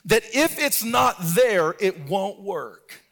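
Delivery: high, loud, ringing sermon delivery; preaching at full force. Acted. Pastor to congregation, high impassioned preaching. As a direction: preach it out over the music.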